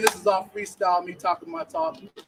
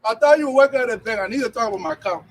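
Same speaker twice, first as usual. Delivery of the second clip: mock party auntie; 7 semitones higher, 6 dB louder than usual. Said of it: "I go to the parties, like".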